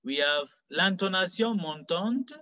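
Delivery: normal talking voice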